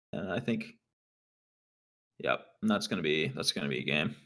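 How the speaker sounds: low, quiet villain menace. Pretend. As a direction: gloat darkly like a villain. Gloating over opponent's doomed game.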